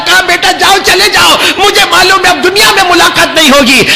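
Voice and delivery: high, loud lamenting preacher's wail; impassioned mourning sermon. Acted. Preacher wailing Ali Akbar's farewell to the gathering.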